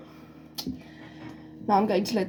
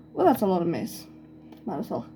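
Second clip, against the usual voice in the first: ordinary talk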